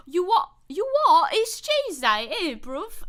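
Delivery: loud, high, hammed-up British bruv; exaggerated British street accent, shouted. Shown as a demonstration. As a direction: put on an outraged British lad accent. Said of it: "I can do a better Tuesday".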